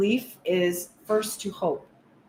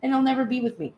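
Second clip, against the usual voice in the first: worried rush; 4 semitones higher, much faster than usual. Retold or imitated.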